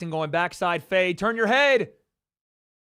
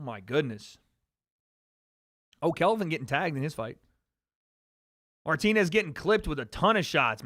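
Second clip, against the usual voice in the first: usual speaking voice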